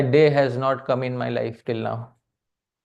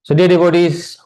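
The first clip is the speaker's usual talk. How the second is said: louder, rallying the crowd; loud call to the audience; 4 semitones higher, 10 dB louder than usual. Real, not acted. Preacher summing up for the devotees, loud call. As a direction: call out to the whole hall.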